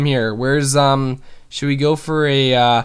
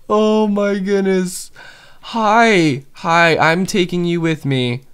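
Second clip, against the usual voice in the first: slower, tender cooing to the panda; 5 semitones higher, slower than usual. Real, not acted. Gamer scooping up his panda, tender coo. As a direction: coo to him like a pet.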